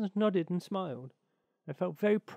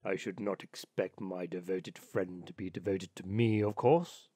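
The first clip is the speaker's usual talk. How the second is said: put-on low old rat voice, lecturing; old water rat; 8 semitones lower than usual. Water rat lecturing the duck on friendship, low self-satisfied voice. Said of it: "cried the water rat".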